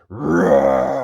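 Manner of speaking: loud roar; lion protecting friends roaring. Acted. Lion scares snakes.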